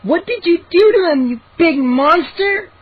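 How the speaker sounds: high accusing shriek. A narrator's reading. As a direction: shriek at the bully in outrage. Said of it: "Mindy shrieked".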